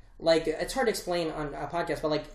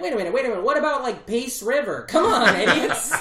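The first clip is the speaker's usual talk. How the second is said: mock settler; 6 semitones higher, 6 dB louder than usual. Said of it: "they're like".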